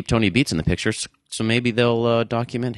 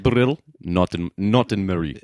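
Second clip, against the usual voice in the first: broken-English Eastern European impression; mock Eastern European accent; 3 semitones lower than usual. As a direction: play it up as a bad Tony Beets accent. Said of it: "a Eastern European accent".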